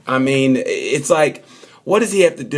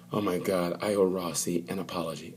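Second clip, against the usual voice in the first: soft, much quieter aside of regret; quiet mock dismay; 6 semitones lower, 13 dB quieter than usual. Dovey to himself after the test, quiet regret. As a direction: murmur it like a sheepish realization.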